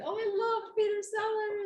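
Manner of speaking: put-on high, loud Indian-accented Peter Sellers voice. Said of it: "You can do an accent like Peter Sellers in The Party?".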